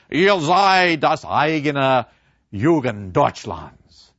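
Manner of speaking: slow, loud German quote of the professor; German words. Retold or imitated. Professor addressing the boys in German, slow and solemn. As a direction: speak the German line slowly, with weight. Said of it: "Professor Kantorek came in and said".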